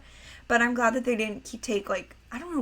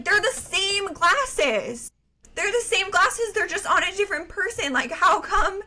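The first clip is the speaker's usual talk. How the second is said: mock indignation; 9 semitones higher, 4 dB louder than usual. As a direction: play up the hurt disbelief. Retold outrage at grandparents' bias.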